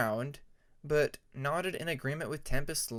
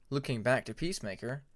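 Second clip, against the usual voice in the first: plain reading voice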